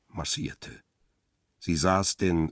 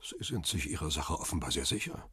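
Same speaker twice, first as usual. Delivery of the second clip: soft, guarded phone voice; quiet retort; 7 dB quieter than usual. Acted. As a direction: answer the caller under your breath. Hiding man retorts to caller.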